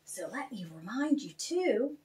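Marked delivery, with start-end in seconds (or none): none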